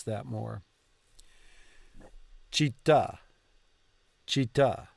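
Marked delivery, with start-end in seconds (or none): 2.5-4.9 città with the stress on the final tà; città stressed on the end. Shown as a demonstration. Learner practicing città, stress on the last syllable. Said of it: "emphasis on the last syllable".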